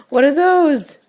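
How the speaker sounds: big gasp, then high loud question; excited wonder. Pretend. Parent turns the page for a toddler, excited wonder. Parent feigns amazement at picture book.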